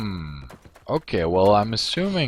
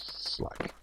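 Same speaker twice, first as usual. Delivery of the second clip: whispered slack; 9 dB quieter than usual. Shown as a demonstration. Streamer whispering a guild name, voiceless. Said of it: "I'm saying slack".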